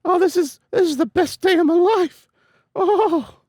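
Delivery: put-on high excited girl voice; gushing high character voice; high girly voice. Voicing excited female character.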